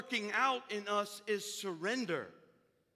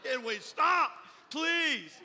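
loud, raised-pitch mock plea; acted exasperation; 6 semitones higher, 9 dB louder, much slower than usual. Helper lugging the box begs her to stop, acted exasperation. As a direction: burst out pleading, hammed up. Burdened helper's imagined outburst.

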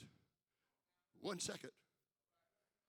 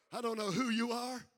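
usual speaking voice

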